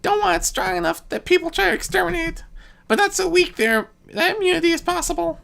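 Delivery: high, quick mischievous trickster; a prankster god explaining a plague, high and light; high Discord voice. A narrator's reading. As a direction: pitch it up and play it gleeful.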